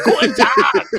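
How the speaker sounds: hammed-up German accent yelled through laughter; mock German shout. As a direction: shout it like a cartoon German, cracking up. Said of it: "he like, uh, what is that?".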